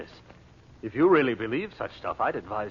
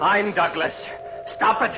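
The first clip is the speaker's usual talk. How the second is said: high, loud panicked cry; loud and high, begging it to stop; panicked shout; 11 semitones higher, 6 dB louder, slower than usual. Acted. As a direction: shout to make the vision stop. Man panics during the crystal vision.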